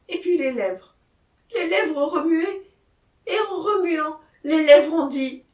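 agitated confession from a shaken man; agitated accused man. Acted. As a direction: tell it urgently, building as the lips speak.

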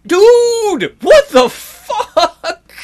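high, loud disbelieving yell; shocked outburst. Real, not acted. Player shocked by his sim, loud disbelieving yell. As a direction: blurt it out in shock.

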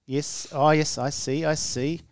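ordinary delivery

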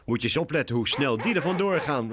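high, loud cocky call; cocky kitten. A narrator's reading. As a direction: show off to a friend. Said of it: "roept Lucy". (stoer katje, hoog en luid)

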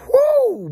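high loud celebratory whoo; a real whoop of delight; excited whoop. Real, not acted. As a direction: let out a big joyful holler. Celebrating a team's wins.

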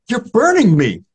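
high, strained voice in pain; the blind beggar cries that Newman's presence burns. Acted.

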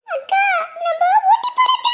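put-on a kid thrilled about the trip, yelling to her sister; excited little girl calling out the good news; excited child shout.

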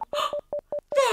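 high sharp gasp of discovery; excited gasp; surprised gasp. Acted. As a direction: gasp as if spotting something. Looking through cosminoculars.

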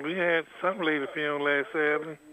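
normal talking voice

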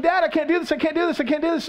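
high-pitched, insistent mock plea; panicky child. Convinced daughter to dad on the bike, panicky protest. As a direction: play a little girl protesting over and over.

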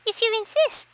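a tiny buzzing wasp; high buzzy insect voice. Acted. Yellow jacket accepts fight.

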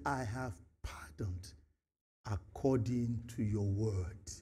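slow, soft, whispered words; whispered pardon. Retold or imitated. God granting pardon in a slow whisper. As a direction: breathe out God's pardon gently. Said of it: "God said".

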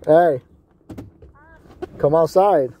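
loud call to come outside. Real, not acted. Man calling his girlfriend outside from the alley, loud. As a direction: raise the voice to call her out.

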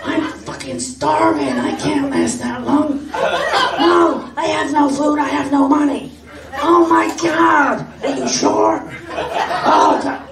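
high, loud, raspy-edged cartoon mom voice, laughing through it; Marge Simpson impression. Storyteller imitates the starving airport lady on the phone, high and loud Marge-style squawk. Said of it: "she was related, I think, to Marge Simpson".

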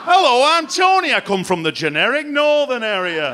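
put-on a foreigner having a go at a northern English accent; generic northern English accent. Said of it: "No one sounds remotely like how I do it".

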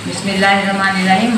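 ordinary delivery

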